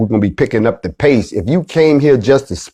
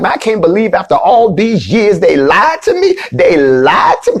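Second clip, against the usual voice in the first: disbelieving churchgoer; 8 semitones higher than usual. Retold or imitated. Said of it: "when you be like".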